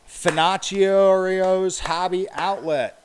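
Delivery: slow and loud, each syllable hit; clapped out syllable by syllable. Shown as a demonstration. Reading a hard name to viewers, clapped out slowly. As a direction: sound it out slowly for the stream chat. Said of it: "Clap out the hard words".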